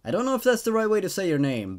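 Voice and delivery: ordinary delivery, nothing marked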